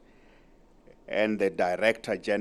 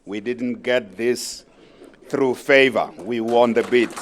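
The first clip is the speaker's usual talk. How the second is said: "through favor" and "We won the bid" thrown out loud, leaning on "won"; rising to a proud, loud declaration; emphatic, louder; 7 dB louder than usual. Real, not acted. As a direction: hammer home that the bid was earned. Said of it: "I want to emphasize".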